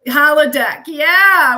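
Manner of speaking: loud, eager yes, that's it; excited recognition. Real, not acted. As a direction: shout it out as the name comes back. Recalling the word holodeck.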